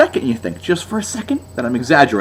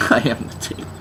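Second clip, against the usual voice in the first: breathy laughing whisper; 2 semitones lower, 8 dB quieter than usual. Shown as a demonstration.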